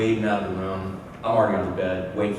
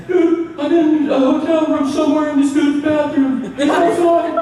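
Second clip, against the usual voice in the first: very high, loud frantic friend; hammed-up terrified yelling; mock panic; 7 dB louder than usual. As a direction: squeal it like a comic hostage on the phone. Kidnapped friend's acted phone call.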